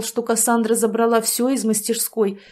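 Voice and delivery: ordinary delivery, nothing marked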